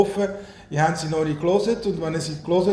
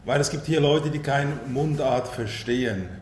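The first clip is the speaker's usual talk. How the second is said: Standard German; 3 semitones lower than usual. Real, not acted. Speaker explains the switch for non-dialect listeners, in Standard German. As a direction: speak Hochdeutsch instead of dialect. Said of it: "stelle ich um auf Hochdeutsch".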